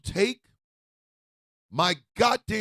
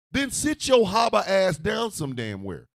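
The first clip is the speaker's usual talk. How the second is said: ordinary talk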